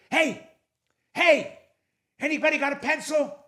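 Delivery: joke-telling mock shout; acted calling out. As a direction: call out high, as if testing who is awake. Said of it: "You didn't scream loud enough".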